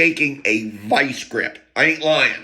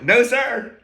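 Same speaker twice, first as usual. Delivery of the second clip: higher, louder, eager "No, sir"; eager reply; 11 semitones higher, 5 dB louder than usual. Retold or imitated. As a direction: answer the cop with barely held glee. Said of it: "and I'm getting excited".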